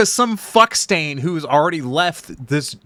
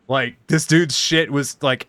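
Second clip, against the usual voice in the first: ordinary delivery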